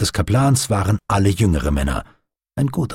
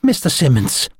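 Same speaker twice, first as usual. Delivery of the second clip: higher, imploring voice; pleading; 5 semitones higher than usual. A narrator's reading. Doctor begs the first officer for care, pleading. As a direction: beg him earnestly. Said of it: "flehte Stephen".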